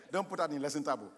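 laughing. Real, not acted. Laughing at the professor.

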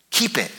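"keep" as a short standalone word, falling; emphatic, falling "keep"; stress on keep. Shown as a demonstration. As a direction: set "keep" down firmly and let "it" drop. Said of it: "to emphasize the different words".

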